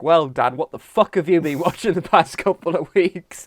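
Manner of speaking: loud, incredulous grilling with a laugh; mock exasperation. Co-host imitating his own blunt questions to Dan. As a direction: grill him in mock outrage. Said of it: "total tonal shift".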